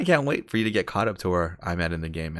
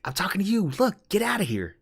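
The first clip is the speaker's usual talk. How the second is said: high-pitched insistent character voice; voice from nowhere; 13 semitones higher, 3 dB louder than usual. Retold or imitated. Tree man warning off the elk hunter, high mock yell. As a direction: imitate someone shooing a stranger, pitched high. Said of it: "He's like".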